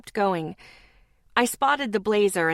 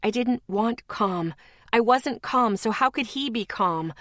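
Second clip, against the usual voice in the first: ordinary delivery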